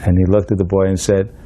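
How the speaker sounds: ordinary talk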